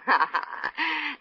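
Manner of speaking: a quick warm laugh at his joke; short fond laugh. Acted. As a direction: laugh lightly, amused.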